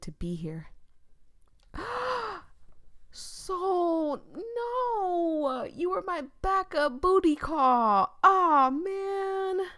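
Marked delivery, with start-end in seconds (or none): 1.7-2.4 high shocked gasp; sharp gasp. Real, not acted. Sharp gasp at seeing the killer. 3.1-9.7 high, loud dismayed wail; dismayed outcry. Real, not acted. High wail on discovering Soul is the killer.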